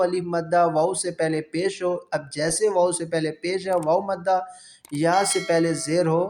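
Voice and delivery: normal talking voice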